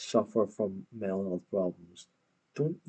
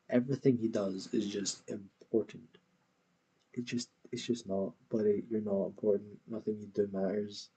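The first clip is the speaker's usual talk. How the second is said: ordinary talk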